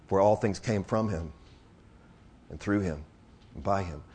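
low, soft, reverent delivery; solemn preaching. Real, not acted. Preacher telling of the judgment borne on the cross, solemn preaching. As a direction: let each phrase settle, hushed and grave.

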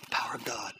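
hushed, breathless whisper. Real, not acted. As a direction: breathe it out in awe. Awed aside after the scripture.

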